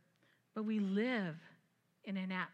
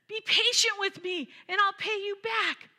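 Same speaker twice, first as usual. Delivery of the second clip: higher, louder pleading; begging; 9 semitones higher, 10 dB louder than usual. Acted. Servant pleads from his knees for patience, higher and louder. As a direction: beg for mercy from your knees. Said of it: "fell to his knees and begged him".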